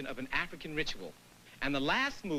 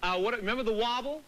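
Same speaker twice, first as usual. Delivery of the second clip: ordinary talk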